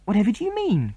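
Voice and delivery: a startled plea, rising high; girl pleading with her friend, mildly startled cry; cried out. Acted. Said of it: "cried Mary".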